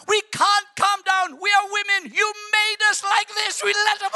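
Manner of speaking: high, loud, indignant women's voice; a hammed-up high-pitched rant; ranting sisters. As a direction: play the sisters venting at Jesus. Sisters ranting, higher put-on voice.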